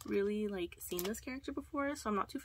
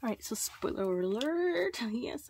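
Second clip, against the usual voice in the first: ordinary delivery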